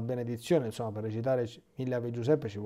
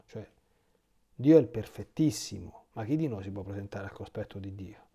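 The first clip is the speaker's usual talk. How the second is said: ordinary talk, nothing marked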